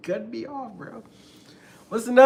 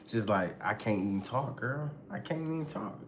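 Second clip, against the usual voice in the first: soft acted giving up; defeated; 5 semitones lower, 9 dB quieter than usual. Partner who keeps getting cut off, quietly defeated. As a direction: let the volume drop away, resigned.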